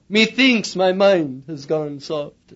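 slow, hammed-up old English lament; drawn out like a Victorian preacher in despair; mock Victorian gloom, very slow; old-fashioned preacher voice. Archaic Spurgeon voice put on.

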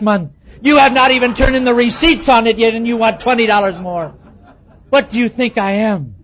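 loud, high hammed-up rant over twenty dollars; hammed-up stingy husband scolding his wife; mock scolding husband.

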